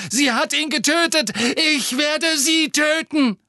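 high, loud vengeful shout; furious yelling. A narrator's reading. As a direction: scream the threat, slow. Harry continues enraged.

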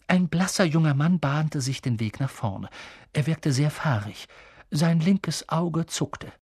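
ordinary delivery, nothing marked

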